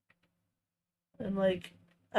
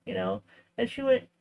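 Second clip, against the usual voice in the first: usual speaking voice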